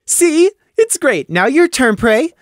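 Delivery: high, loud, fishing for praise; eager mayor. Acted. Taffy asks Crimson, high and eager. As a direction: push for a compliment.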